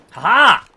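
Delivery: loud gloating ha-ha; triumphant laugh. Real, not acted. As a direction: crow with delight. Reversed opponent's finisher, triumphant.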